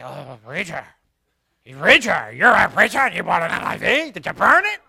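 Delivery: loud, scandalized outburst; mock outrage. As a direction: play an aghast churchgoer shouting at the preacher. Mock shocked churchgoer challenging the preacher.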